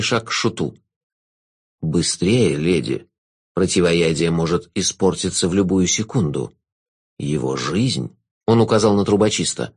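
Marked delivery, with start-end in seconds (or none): 1.8-8.2 low, slow, velvety jester; sinister jester. Acted.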